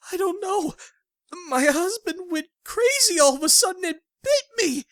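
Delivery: high, shocked frightened voice. Acted. Bitten wife explaining the attack, frightened. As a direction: blurt it out in shock.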